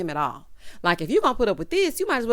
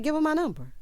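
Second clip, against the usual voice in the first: retold quick reply; 7 semitones higher, faster than usual. Retold casual first answer.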